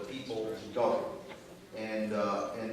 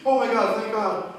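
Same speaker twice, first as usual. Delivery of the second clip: high, loud relieved cry; loud relief; 11 semitones higher, 11 dB louder than usual. Acted. Old man relieved in joke.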